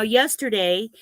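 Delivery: normal talking voice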